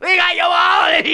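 very high hollering hillbilly voice with laughter; shouting hillbilly gang. Retold or imitated. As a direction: whoop it out high, cackling as you go. Hillbillies pile in waving.

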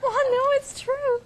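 high, squeaky laughing voice; laughing so hard it cracks. Real, not acted. Laughing hard after Flintstone joke.

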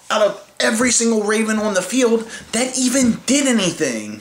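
normal talking voice

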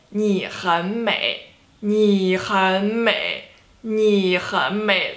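你很美 slowly, syllable by syllable in third tone; three third tones. Shown as a demonstration. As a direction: draw out each tone of 你很美. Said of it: "Third tone, third tone, third tone".

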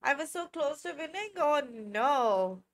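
ordinary talk

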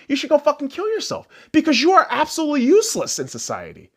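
usual speaking voice

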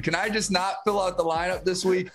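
delighted, teasing excitement; thrilled. Real, not acted. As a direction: cheer the other guy's bold strategy. Cohost thrilled by the picks.

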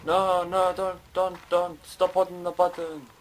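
hammed-up frantic protest; mock panicked pleading. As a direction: play the game character begging you to let go. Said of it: "It's like".

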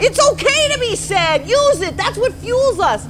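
rally them up, pitched high; pep talk. Acted. Boss ghost fires up Donaldson.

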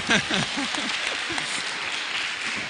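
real high, breathy burst of laughter; laughing. Real, not acted. Host laughs as contestants jump the gun, laughing.

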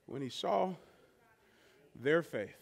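soft and low, barely above a breath; hushed preaching. Acted. Pastor winding down the sermon, soft and low. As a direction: let it fall quiet after the storm. After "land this plane", whew.

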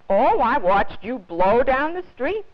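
a hearty officer taking his time; big policeman voice. A narrator's reading. Officer tells Robert how he spotted him, slow and hearty.

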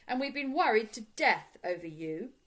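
loud, emphatic reproach; firm. A narrator's reading. Mister Brown telling Paddington they've been worried, firm. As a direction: scold firmly, with weight on the worry. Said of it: "said Mister Brown firmly".

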